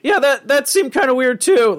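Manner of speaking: put-on high, baffled teen voice; Morty impression. Imitating Morty puzzling over the Pop-Tart toaster, high whine. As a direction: pitch it way up, baffled and whiny.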